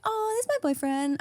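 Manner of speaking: put-on high, breezy girlish voice; mock her carefree answer; imitating the girlfriend. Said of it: "And she's like".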